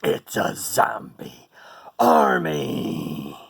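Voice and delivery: slow whisper rising to a cry; dramatic reveal. Pretend. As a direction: hush then burst out.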